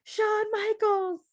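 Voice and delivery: high, slow, stretched-out call of the name; mock yell. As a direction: call him out like a fan, drawn out. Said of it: "I have to yell at you".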